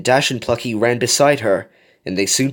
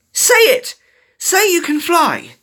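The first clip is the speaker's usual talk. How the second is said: high, loud demand, pushing the other on; forceful high-pitched coaching; urgent motivating shout; 5 dB louder than usual. A narrator's reading. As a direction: egg them on at the top of your voice. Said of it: "continued to motivate the young falcon".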